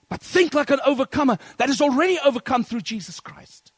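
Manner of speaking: loud, high climactic cry; preacher crying out Jesus's call to overcome; full-force preaching. Acted. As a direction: hit the peak of the sermon.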